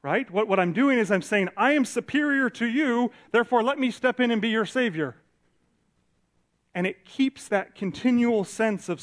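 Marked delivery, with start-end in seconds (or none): 1.6-5.2 higher, louder, lofty and condescending; a hammed-up lofty savior speaking down; mock superior tone. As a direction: play the self-appointed savior looking down.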